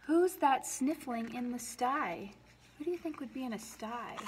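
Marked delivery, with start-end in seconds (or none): none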